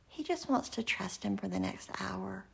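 normal talking voice

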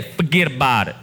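rough attempt at a Jersey accent. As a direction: imitate a Jersey guy's accent. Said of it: "He still sounds like he's from Jersey".

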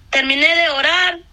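ordinary talk

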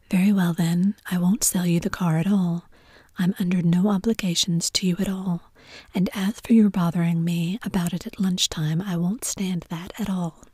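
plain reading voice

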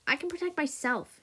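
high-pitched, mild yell; indignant yell. A narrator's reading. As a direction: protest indignantly, pitch shooting up. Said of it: "she yelled".

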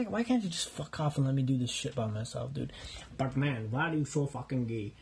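ordinary delivery

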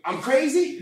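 loud, high indignant yell; irate disbelief. Retold or imitated. Shouting at hospital security, loud indignant disbelief. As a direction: throw it back at them.

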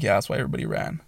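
understated, resigned reply; retold deadpan answer. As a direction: drop it low and matter-of-fact. His retold flat answer to friends.

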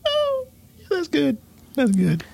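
high squeals through laughter; laughing. Real, not acted. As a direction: laugh out the praise. Laughing after beef joke.

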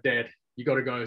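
ordinary talk, nothing marked

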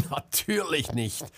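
high burst of laugh, then the words; forced laugh. A narrator's reading. Selma scoffing at Marcel's doubt, forced laugh. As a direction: squeeze out a laugh before speaking. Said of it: "drückte ein Lachen heraus".

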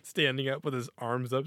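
usual speaking voice

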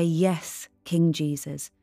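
ordinary talk, nothing marked

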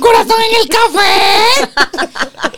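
shrieking, slow jealous accusation; very high jealous outburst with a laugh; jealous wife. Retold or imitated. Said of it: "le escribe a Adi".

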